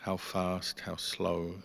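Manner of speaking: ordinary talk, nothing marked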